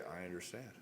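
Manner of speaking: very soft, low acknowledgement; understanding reply. Shown as a demonstration. Models calm reply to upset wife.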